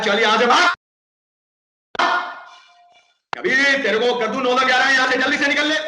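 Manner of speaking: high, loud angry shooing; angry carpenter. Acted. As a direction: snap him out the door. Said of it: "बढ़ई को आता है गुस्सा".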